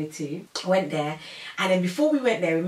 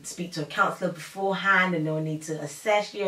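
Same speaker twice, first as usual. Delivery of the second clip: ordinary delivery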